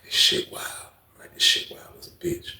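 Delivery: whispered amazement; hushed disbelief. Retold or imitated. Narrator murmuring about the chaos, hushed. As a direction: whisper it to yourself.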